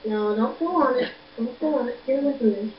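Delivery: ordinary talk, nothing marked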